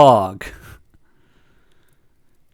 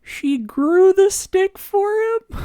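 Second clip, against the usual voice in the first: very high, drawn-out melting question; high, slow awww voice; touched cooing; much slower than usual. Real, not acted. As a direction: squeal it slowly, as if melting at something cute. Melting at character's sweet gift.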